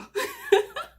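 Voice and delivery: high nervous giggle; embarrassed squeaky laugh. Real, not acted.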